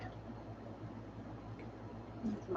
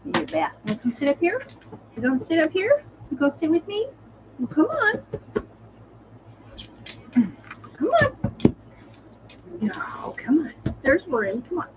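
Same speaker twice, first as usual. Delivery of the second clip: coaxing the dog; 8 semitones higher than usual. Real, not acted. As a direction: coax her up beside you, high and sweet. Talking sweetly to her dog.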